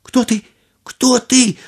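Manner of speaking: high, startled scared shout; frightened cry. A narrator's reading. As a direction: cry out in terror. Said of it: "закричал Аладдин".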